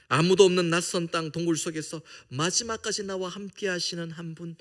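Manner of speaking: ordinary delivery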